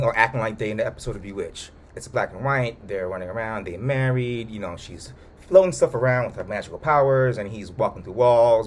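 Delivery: usual speaking voice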